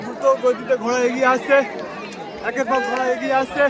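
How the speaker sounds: high, loud excited race call; race caller. Acted. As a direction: ride the rising excitement of the race. Race starts, commentator excited. (উত্তেজিত ঘোড়দৌড়ের ধারাভাষ্য)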